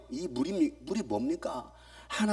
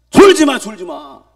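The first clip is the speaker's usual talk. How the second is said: 천천히 크게 '졸지 마'; 조는 사람 깨우듯 크게 천천히; loud mock warning; 15 dB louder, slower than usual. Voicing ghost lulling sleepers. (천천히 크게 외치는 경고)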